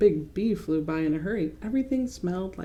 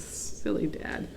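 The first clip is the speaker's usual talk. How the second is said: ordinary delivery, nothing marked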